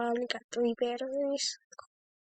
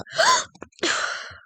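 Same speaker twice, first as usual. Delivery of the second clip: sharp high gasp; frightened gasp; 9 dB louder than usual. Real, not acted. Sudden fright in horror game.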